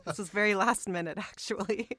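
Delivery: laughing through the words. Real, not acted. Laughing about last-minute casting.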